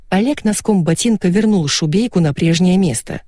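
ordinary delivery

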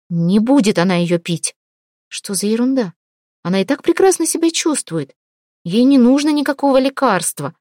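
ordinary delivery, nothing marked

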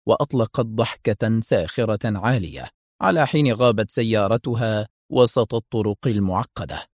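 ordinary delivery, nothing marked

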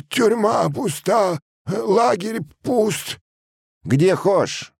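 very high, drawn-out wail of excuses; pleading peasant. A narrator's reading. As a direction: plead helplessly, slow and high. (мужик жалобно оправдывается)

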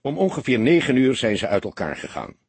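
plain reading voice

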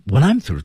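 high "I'm", dropping into "through"; pitch peak on I'm. Shown as a demonstration. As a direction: raise the pitch sharply on "I'm". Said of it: "音调的高低在I am这块儿".

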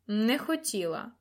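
slow, sarcastic disbelief; mocking echo. A narrator's reading. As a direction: scornfully throw her excuse back at her. Lord mocks lady's frightened plea. (глузливо, повільно)

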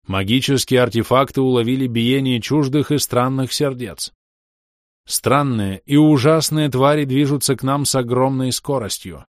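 loud, urgent public address; alarm announcement. Acted. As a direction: announce the danger over loudspeakers.

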